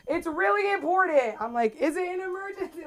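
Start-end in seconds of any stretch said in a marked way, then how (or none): 0.1-1.4 insistent dad. Retold or imitated. Dad insists his news matters. 1.8-2.8 incredulous question. Retold or imitated. Daughter questions dad's urgent news.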